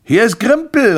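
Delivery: high, loud call through a letterbox. A narrator's reading. Grimpel calls through the letterbox, loud high announcing call. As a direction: call out to someone behind a closed door. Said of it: "rief er durch den Briefkastenschlitz".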